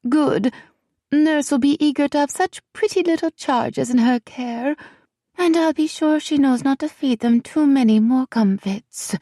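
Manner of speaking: high, sweetly imperious royal voice; a tiny queen dismissing everyone. Acted. Queen gives orders.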